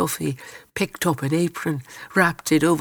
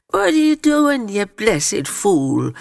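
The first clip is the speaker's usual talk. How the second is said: high, loud start, dropping into a drawn-out end; indignant outburst; 6 semitones higher, 3 dB louder than usual. A narrator's reading. Matty to Louis, pulled from bed, indignant outburst. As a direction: burst out high, then drag out the insult. Man roughly woken, takes it as jest.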